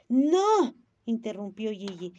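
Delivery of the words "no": sharp no. A narrator's reading. Gigi interrupts the lady.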